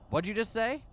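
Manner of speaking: high, sharp threat; provoked; menacing. Acted. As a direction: dare him to repeat. Susumu provoked.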